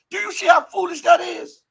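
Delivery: high, loud disbelief; outraged preacher's challenge; incredulous shout. Real, not acted. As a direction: fling it at the audience. Outraged rhetorical question.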